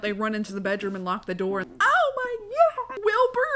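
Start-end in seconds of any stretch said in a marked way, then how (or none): 1.6-3.5 much higher, louder, slow hammed-up scream; panicked shriek. The Dursleys panicking at the pig tail, shrieking. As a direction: shriek in mock terror.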